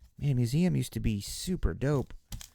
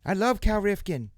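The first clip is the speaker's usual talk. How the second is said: put-on kids' Cal Rifkin; 9 semitones higher than usual. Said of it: "what the little kids used to say".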